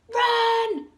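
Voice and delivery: very high, loud alarm; monkey yelling a warning to the children; shouted run. A narrator's reading. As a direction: scream at them to run. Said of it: "Mugglewump shouted".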